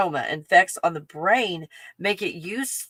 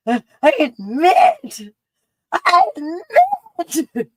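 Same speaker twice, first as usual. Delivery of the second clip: high, slow, giggling mock confession; laughing confession; 11 semitones higher, 7 dB louder, much slower than usual. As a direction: squeal it out through laughter. Imagined police stop, giggling.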